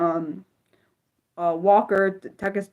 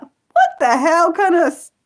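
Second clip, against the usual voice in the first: outraged disbelief; 9 semitones higher, 6 dB louder than usual. Real, not acted. Hearing of American slap.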